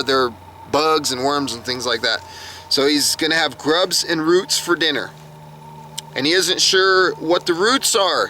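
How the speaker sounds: ordinary talk, nothing marked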